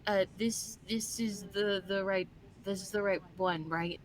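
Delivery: soft, hesitant checking; small, faltering voice; unsure character. Acted. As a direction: ask quietly, stumbling for reassurance. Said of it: "will look at Bitsy and go".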